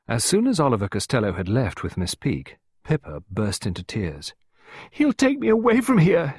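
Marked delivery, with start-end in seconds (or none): 4.9-6.4 put-on high, loud, distraught child; tearful cry. A narrator's reading. Pippa clinging to Clarissa, crying out in tears. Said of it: "she cried, sobbing bitterly".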